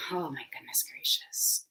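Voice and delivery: hushed, unvoiced murmur of wonder; whispered aside. Real, not acted. Host marvelling to herself before starting, whispering. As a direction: breathe it out under your breath.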